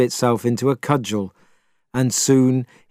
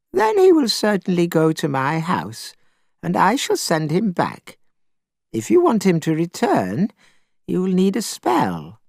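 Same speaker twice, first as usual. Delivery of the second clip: put-on old woman voice; 6 semitones higher than usual. A narrator's reading. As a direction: speak gently as a grieving old woman.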